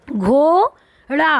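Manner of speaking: drawn-out घोड़ा, sounded slowly as one word; slow blended word. Shown as a demonstration. Teacher to children, slowly blending घोड़ा. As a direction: stretch घोड़ा out slowly for the class. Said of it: "तो घोड़ा".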